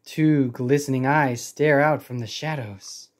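usual speaking voice

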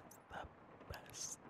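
barely-there whisper, drawn out slowly; faint slow whisper. Acted. Girlfriend repeating her teasing line to her partner, fainter slow whisper. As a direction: trail off into the faintest slow breath.

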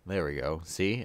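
ordinary talk

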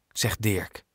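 ordinary delivery

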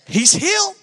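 high, excited shout of a mother; mother crying out that her son is healed; mom's cry of joy. Retold or imitated. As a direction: call it out like a thrilled mom. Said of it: "she's like".

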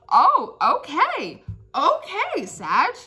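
high drawn-out okay; sassy sing-song okay. Real, not acted. Reacting after singing.

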